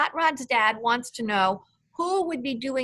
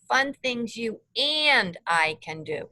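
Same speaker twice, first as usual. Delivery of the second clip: "and" held long and raised above the rest; stress on AND. Shown as a demonstration. Teacher prompting a pupil with the quote, stress on "and". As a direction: punch and stretch the word "and". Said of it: "he emphasized that word".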